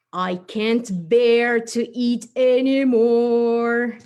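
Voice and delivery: loud, slow, hammed-up bear. Bear character fasting delivers the joke, loud and slow. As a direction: boom out the pun like a big cartoon bear. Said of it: "He said".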